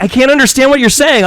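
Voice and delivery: high, loud mock complaint; imitated exasperated wife. Wife who cannot hear husband's good morning, mock exasperation.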